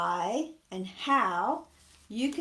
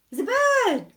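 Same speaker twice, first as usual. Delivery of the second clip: excited; 12 semitones higher, 9 dB louder than usual. Acted. Reveal to children in song.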